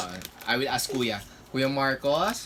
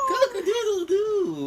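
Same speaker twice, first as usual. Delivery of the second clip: high crowing rooster call, much higher; 5 dB louder than usual. Retold or imitated. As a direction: crow it like a rooster for a toddler. Dad imitates rooster for child.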